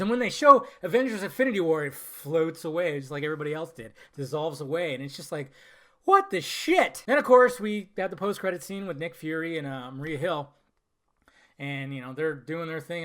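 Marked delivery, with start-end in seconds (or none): 6.0-7.0 very high, loud disbelief; acted shock. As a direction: exclaim it in high-pitched outrage. Acts shocked reaction to ending.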